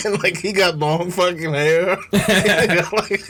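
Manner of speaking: words through laughter; laughing. Real, not acted. Cracking up while telling his friend the story.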